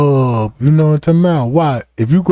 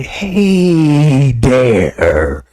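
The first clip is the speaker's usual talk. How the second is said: low, long-drawn greeting blown out; mocking breath-heavy lawyer; lawyer blowing breath; 5 semitones lower than usual. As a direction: stretch it out and breathe it all over their face. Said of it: "blowed all that shit in my face".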